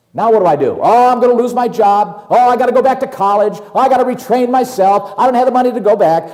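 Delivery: rising, fast, fretful worrying; panicky worker. Retold or imitated. Preacher voicing a worker afraid of losing his job, panicky. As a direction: spiral into panic about the job.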